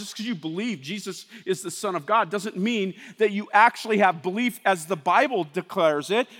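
ordinary talk

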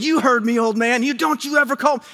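high-pitched, heated mock comeback; retold yell. Pastor arguing with his neighbor, retold yelling. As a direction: fire back in an acted temper. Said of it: "I just yelled at my neighbor".

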